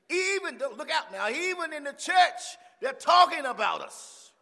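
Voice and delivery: high, loud, shouted sermon; full-force preaching. Real, not acted. Preacher warning of gossip in church, shouting. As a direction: shout it at the congregation.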